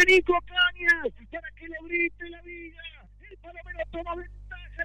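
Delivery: high excited goal recap; goal commentary. Real, not acted. Post-goal call.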